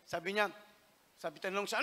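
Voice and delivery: usual speaking voice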